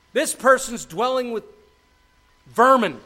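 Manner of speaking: high, loud denunciation; shouting out. Acted. Wisdom denouncing the back-alley rival, shouting out. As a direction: call out the rival for all to hear. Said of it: "I'm shouting out".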